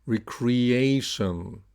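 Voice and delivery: slow "Recreation", both E and A clear; "Recreation" with a long, stressed A; repeat-after-me model. Shown as a demonstration. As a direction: stretch "Recreation" as re-cre-A-tion. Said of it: "So repeat after me".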